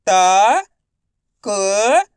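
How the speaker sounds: त held long, then क held; letter-by-letter sounding. Shown as a demonstration. Teacher for pupils to repeat, त and क sounded alone. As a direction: stretch त and क separately. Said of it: "आपको मेरे साथ साथ रिपीट करना है".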